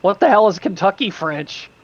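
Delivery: ordinary talk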